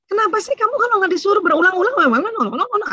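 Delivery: high, fast, nagging complaint; exasperated scolding mother. Retold or imitated. Mother scolding her child who must be told again and again, exasperated and high. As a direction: scold the child for needing every order repeated. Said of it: "Ah, itu ibunya".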